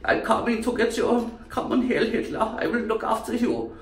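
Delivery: high, croaky German voice; German accent. Acted. German coming to get you, high croaky German accent. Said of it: "the Germans".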